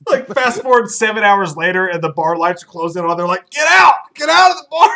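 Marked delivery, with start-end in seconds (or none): 3.5-4.9 loud high yell; shouted get out. Retold or imitated. Bouncers kicking them out at closing, loud yell. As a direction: bellow like closing-time staff. Bar staff yelling closing.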